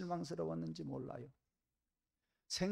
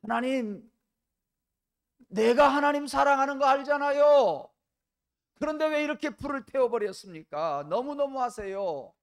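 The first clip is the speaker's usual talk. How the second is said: much higher and louder pleading wail; wailing reproach; 12 semitones higher, 16 dB louder than usual. Acted. Castaway reproaching God he loves, high loud wail. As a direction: cry out hurt and betrayed. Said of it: "하나님을 원망했습니다".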